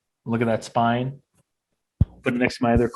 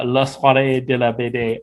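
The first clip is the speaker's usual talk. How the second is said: a West Coaster's modest French accent. Shown as a demonstration. Announcing the event's French name, shy French accent. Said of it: "Please forgive my French accent".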